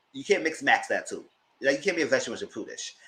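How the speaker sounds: ordinary talk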